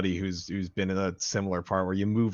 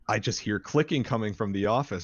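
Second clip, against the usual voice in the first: ordinary delivery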